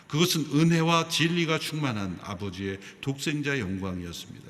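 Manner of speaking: loud, steady reading of the verses; scripture reading. Acted. Pastor reading the Gospel with the church, loud recital. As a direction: read the Bible aloud with the congregation.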